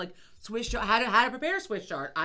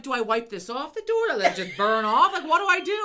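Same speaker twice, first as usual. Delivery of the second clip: higher, louder, half-laughing panic; panicked, laughing retold question; 5 semitones higher, 8 dB louder than usual. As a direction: act out the kitchen panic with a laugh. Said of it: "I'm like".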